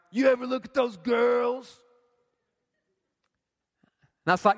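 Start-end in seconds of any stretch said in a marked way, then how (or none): 0.1-1.8 hammed-up sleazy dude calling out; mock leering shout; slimy guy voice, louder. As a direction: push the creep voice harder. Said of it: "in my mind he did".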